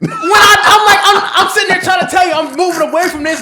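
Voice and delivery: loud, high-pitched laughing outburst; laughing exasperation. Real, not acted. Host teasing his friend over late advice, loud laughing exasperation. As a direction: protest loudly through laughter.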